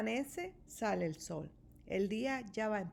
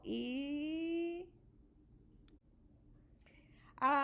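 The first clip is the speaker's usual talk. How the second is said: big drawn-out sneeze; bear sneeze; 4 semitones higher, 4 dB quieter, much slower than usual. A narrator's reading. Pepper reaches the sleeping bear, big sneeze. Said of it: "El gran oso ha estornudado".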